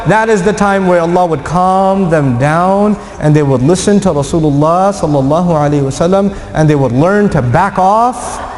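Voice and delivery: ordinary delivery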